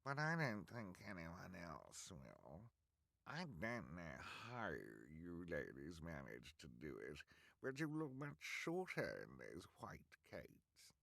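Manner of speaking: slow, oily henchman voice; mock them like a smug crony; sneering henchman. Bald man voiced.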